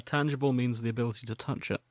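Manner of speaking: normal talking voice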